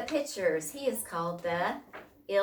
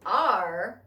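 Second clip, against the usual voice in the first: loud "Arr" of agreement; storybook crew chiming in; pirate crew's cry; 7 dB louder than usual. A narrator's reading. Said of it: "cried the crew".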